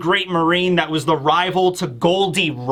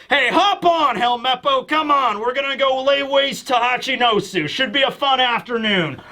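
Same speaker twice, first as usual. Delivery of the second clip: higher-pitched, cheery mock call to hop aboard; breezy rallying call; 6 semitones higher than usual. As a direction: play a carefree old hero inviting someone along. Acts Garp breezily calling Helmeppo aboard.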